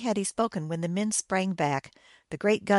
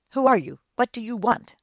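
higher, louder challenge; sudden demanding questions, voice raised; alarmed cry; 4 semitones higher, 6 dB louder than usual. A narrator's reading. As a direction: cry out at an intruder. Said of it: "I cried in Russian".

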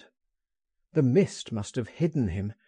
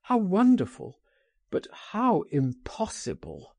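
high, swelling wonder that drops into regret; crying out; 6 semitones higher than usual. A narrator's reading. Torn friend answers a tempting escape plan, crying out. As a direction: exclaim with longing, then give up. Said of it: "he cried".

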